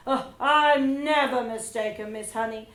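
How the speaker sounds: loud, smug and certain; arrogant dismissal. Acted. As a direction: brush her off with total certainty. Trunchbull dismisses Miss Honey.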